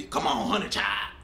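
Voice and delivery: a hint of a southern nanny coaxing a child; mock southern accent calling the baby; southern mammy coaxing.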